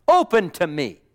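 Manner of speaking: loud, emphatic call; emphatic preaching. Retold or imitated. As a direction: proclaim it loudly from the pulpit. Repeats the quote in preaching voice.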